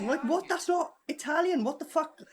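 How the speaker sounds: high-pitched, incredulous protest; baffled disbelief. Real, not acted. Baffled by the actor's accent.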